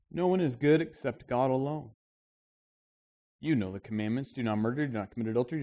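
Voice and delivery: steady pulpit Bible reading; measured reading of a Gospel story; scripture reading. Acted. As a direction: read the passage to the congregation. Reads Mark ten aloud.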